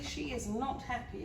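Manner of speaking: usual speaking voice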